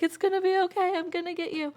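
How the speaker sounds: high, gentle reassurance to a trapped dog; soothing the dog. Retold or imitated. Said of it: "I'm talking to him through the deck".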